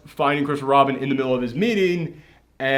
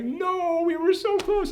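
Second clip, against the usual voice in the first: very high, soft imitated wail; mock despairing wail; 4 dB quieter, slower than usual. Daughter loses the papers in wind, mock wailing. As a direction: cry out in small-voiced mock despair. Voices girl losing the papers.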